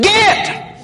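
very high snapped shout; sharp command. Retold or imitated. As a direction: bark one word to scare off a dog. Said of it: "She stomped her foot and said".

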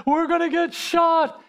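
high-pitched imitated wail; hammed-up tearful panic, very high; mock crying. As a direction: act out a panicking soldier crying out. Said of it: "he's crying".

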